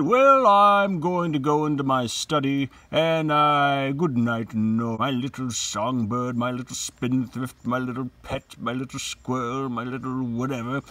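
lightly voiced Torvald, loud then fading to fond pet names; a hint of a patronizing husband; voicing Torvald. Teacher voicing Torvald's goodnight pet names to Nora, lightly patronizing. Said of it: "Torvald has said".

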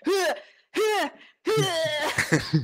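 mock straining grunts; tennis grunt. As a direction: huff like failing a climb. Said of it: "you're just like".

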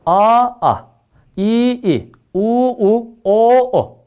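each vowel said long and then short; vowels shortened. Shown as a demonstration. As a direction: show "A, a" as a long vowel, then a clipped one. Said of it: "Aha zihaf yaptım".

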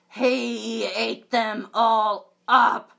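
loud, slow accusing; indignant Dot. Acted. Dot telling on the baby wolf, loud and slow. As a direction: spell out the crime, loud and slow.

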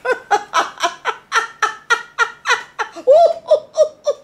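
very high, loud squealing laugh; high shrieking laugh. Real, not acted. Delighted by her memories, squealing laugh.

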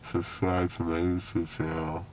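slurred after anesthesia; words blurred by a numb mouth; numb from the dentist. Real, not acted. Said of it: "everything in my mouth feels numb".

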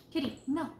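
loud firm no to the cat; scolding the cat. Real, not acted. Cat back at the chair, loud scolding.

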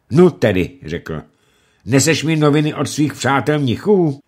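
loud mocking challenge; angry lord taunting captive; menacing taunt. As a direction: sneer at the prisoner. Lumey taunting prisoner.

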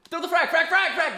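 frantic laughing grenade yell; grenade warning yell. Real, not acted. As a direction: scream for the frag to be thrown. Live firefight, grenade call.

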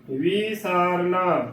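ਵੀ ਸਾਰਨਾ opening on a long, slow "vee"; wrong long-i reading. Shown as a demonstration. Teacher showing how congregations misread it, ਵੀ ਸਾਰਨਾ with long "vee". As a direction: stretch "ee" at the start of ਵੀ ਸਾਰਨਾ. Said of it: "ਸੰਗਤਾਂ ਕਿਵੇਂ ਉਚਾਰਨ ਕਰਦੀਆਂ".

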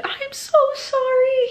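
high, slow whiny fake sorry; mock his apology in a high whine. Mocking Jeremiah's fake apology to Belly, high whine. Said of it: "play this whole".

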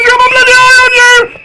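rising to a high yell; shouting threat. Real, not acted. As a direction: yell a warning at the monster. Threatening game monster.

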